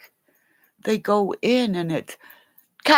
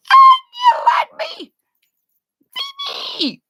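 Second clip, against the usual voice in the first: shrieked; 4 dB louder than usual. Real, not acted. Shrieked plea.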